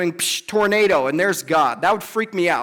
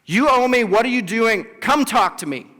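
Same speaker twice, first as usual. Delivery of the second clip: higher, louder demand to God; demanding answer, much higher and louder; 5 semitones higher, 3 dB louder than usual. Retold or imitated. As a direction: insist on an answer at full voice. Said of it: "he actually demands an answer".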